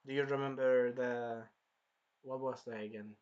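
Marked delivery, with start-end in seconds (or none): none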